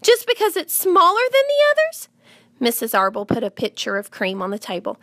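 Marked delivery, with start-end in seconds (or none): 0.0-2.1 put-on high, horrified child's protest; outraged girl's high-pitched questioning; shrieked. A narrator's reading. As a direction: cry out in appalled disbelief, pitch way up. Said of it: "shrieked Fern".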